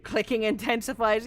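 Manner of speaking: ordinary talk, nothing marked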